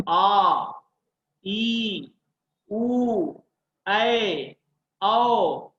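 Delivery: आ, ई, उ, ए, औ each held long and falling; long vowels. Shown as a demonstration. Teacher listing the big matras, each vowel drawn out. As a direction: stretch each vowel out separately. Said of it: "तो बड़ा क्या".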